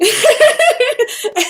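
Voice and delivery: bursting into a high, loud real laugh; cracking up mid-story; a loud, high, real burst of laughter. Real, not acted. Reader laughs at own giant voice.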